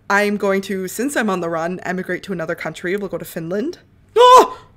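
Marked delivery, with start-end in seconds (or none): 4.2-4.6 sharp, very high, loud cry; shocked shout. Real, not acted. Player sees her character die, shouting high and loud. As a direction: blurts out in shock.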